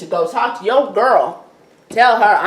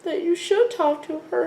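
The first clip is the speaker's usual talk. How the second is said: whiny Drew; 11 semitones higher, 6 dB quieter than usual. Retold or imitated. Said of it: "Drew is like".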